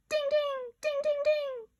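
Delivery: high bell-like dings; chiming dings. Acted. Kids imitating the sale notification, high chimes. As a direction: ring out like a chime.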